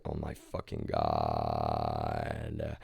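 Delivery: very low, slow, drawn-out groan of shock; mock shocked groan. As a stunned onlooker reacting to a kid walking in, a deep slow groan. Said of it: "it's like".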